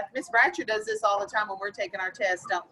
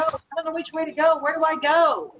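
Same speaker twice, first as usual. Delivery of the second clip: mock frantic antelope; 4 semitones higher than usual. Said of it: "that is frantic".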